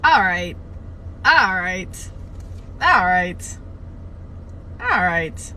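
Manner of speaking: loud, punched first syllable, dropping away; forceful, repeated self-steadying exclamation; emphatic all right. Real, not acted. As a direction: psych yourself up loudly. Composing self after racy passage.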